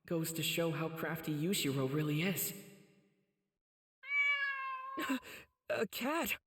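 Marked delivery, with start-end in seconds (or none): 4.0-5.4 a high, bright burst of laughter; a quick happy laugh; delighted laugh. Acted. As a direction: laugh with sudden delight at the cat.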